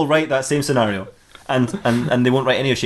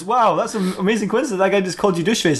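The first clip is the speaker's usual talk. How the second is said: amazed; 6 semitones higher than usual. Acted. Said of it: "and he goes".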